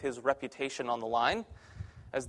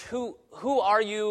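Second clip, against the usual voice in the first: higher, slow broken-English question; 5 semitones higher, slower than usual. Retold or imitated. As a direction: imitate a wary learner of English, drawn out. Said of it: "they ask me sometimes in broken English".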